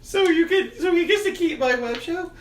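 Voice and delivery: loud falsetto courtroom protest; high mock character voice. Arguing over a web show in court, loud falsetto mock protest. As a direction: squeal it way up high, hammed up. Retold courtroom exchange, put-on voice.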